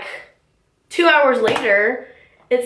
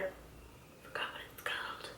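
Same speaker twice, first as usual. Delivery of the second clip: whispered to herself; quiet whispered aside; 28 dB quieter than usual. Real, not acted. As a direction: mutter it under your breath as an aside.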